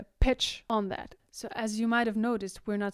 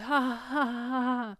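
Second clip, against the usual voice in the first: tripping staccato ha; 2 semitones higher than usual. Shown as a demonstration. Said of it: "we tend to do like".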